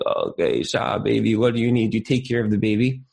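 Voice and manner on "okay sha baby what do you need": groggy parent. Retold or imitated. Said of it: "you're like".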